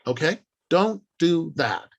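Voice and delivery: ordinary talk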